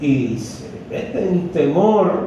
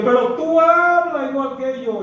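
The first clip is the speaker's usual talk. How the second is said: 1 accusing servant; 8 semitones higher than usual. Acted.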